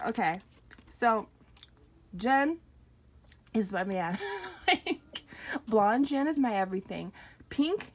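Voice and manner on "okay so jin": ordinary talk, nothing marked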